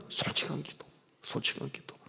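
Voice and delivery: whispered echo. Acted. Pastor echoing his point to the congregation in a whisper. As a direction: repeat it softly twice.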